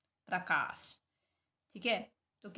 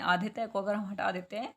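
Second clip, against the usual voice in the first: normal talking voice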